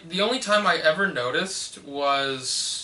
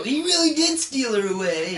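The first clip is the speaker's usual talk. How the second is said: mock whine; 8 semitones higher than usual. Echoing the whiny Harry voice.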